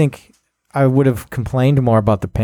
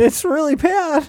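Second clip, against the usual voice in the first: laughing through pain; slower than usual. Real, not acted. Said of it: "And it hurts to laugh".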